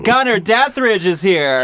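normal talking voice

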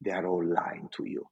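imitating a boss's hushed warning; retold grave whisper. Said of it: "and he says".

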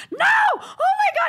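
acted squealing panic; panicked mock shriek. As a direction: shriek it like someone desperate to stop a send. Acting drunk friend's panicked protest.

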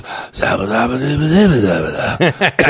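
mock Seagal gibberish. Retelling a comic's Seagal bit, mock gibberish mumble. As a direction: mumble made-up syllables like a lazy action star. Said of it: "and walks in and goes".

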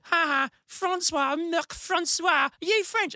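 high, sing-song playground taunt; mocking schoolkids. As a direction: tease him like a cruel kid in the schoolyard. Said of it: "everyone was like".

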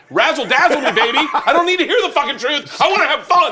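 loud, high showbiz excitement; over-the-top eager listener; hammed-up demand. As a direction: demand to be entertained, pitch way up. Acts out demanding an exciting story.

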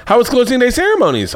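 high, cheery oblivious mom voice; a lightly voiced chirpy mom asking. Mom greeting her son in a Speedo, chirpy and high. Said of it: "my mom just was like".

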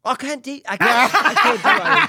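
high, laughing protest. Real, not acted. Asked to do an accent and unable, laughing high. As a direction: protest through laughter, pitch shooting up.

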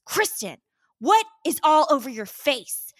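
mock angry father shouting at his daughter; imitated dad yelling. Dad confronting tipsy daughter on the porch, mock yelling. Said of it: "he's yelling at me".

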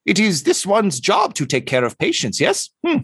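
high, eager avian voice; bird doctor. Acted. Bird doctor assures Tortle about patients, high creature voice.

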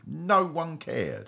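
usual speaking voice

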